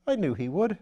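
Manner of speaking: put-on an airy young lord teasing his valet; Lord Peter.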